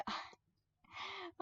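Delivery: fast, giggling through the words; can't hold the laughter in; real laughing fit. Real, not acted. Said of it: "I can't stop laughing".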